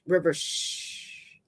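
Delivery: soft, slow hush; coaxing. A narrator's reading. Dave calming River in his arms, soft coaxing. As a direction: hush gently with a drawn-out shh. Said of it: "he coaxes".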